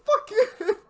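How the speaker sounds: high, loud laugh-cry; cackling fake outrage; laughing mock insult. Real, not acted. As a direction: shout it through laughter.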